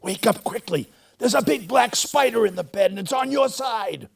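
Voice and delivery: high, loud mock-alarm shout; scary urgent warning. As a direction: pretend real danger to scare her twin. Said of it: "making her voice scary and urgent".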